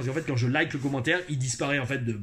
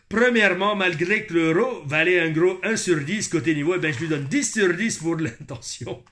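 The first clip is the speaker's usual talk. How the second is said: put-on Quebec accent; 4 dB louder than usual. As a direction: put on a lively Québécois accent. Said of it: "notez mon accent québécois". (accent québécois appuyé)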